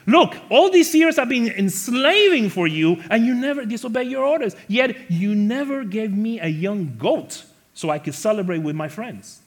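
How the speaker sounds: high, loud resentful outburst; retold angry complaint. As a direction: voice the son's bitter protest. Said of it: "he answered his father".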